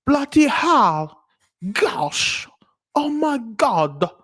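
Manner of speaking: high, slow hammed-up British; exaggerated posh Brit exclaiming; mock British accent. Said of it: "Be like".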